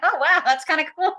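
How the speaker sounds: high, loud, delighted; thrilled surprise. Retold or imitated. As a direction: gush with happy surprise. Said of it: "I'm like".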